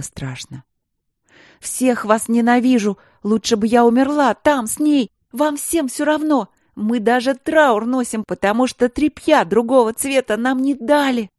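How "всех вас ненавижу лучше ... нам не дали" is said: high, loud hysterical yelling; screaming. A narrator's reading. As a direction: scream out the grief. Sister screaming without tears.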